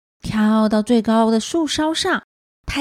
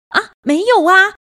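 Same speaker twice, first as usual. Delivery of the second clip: startled, higher-pitched innocence; hasty denial; 5 semitones higher, 4 dB louder than usual. A narrator's reading. Child caught gossiping by mother, flustered denial. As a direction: deny it quickly, lightly flustered.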